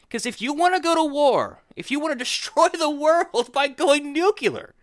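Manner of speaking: high, exasperated and incredulous; rising outrage, half laughing; exasperated rant. Real, not acted. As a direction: plead with the audience in disbelief. Heated rant, much higher.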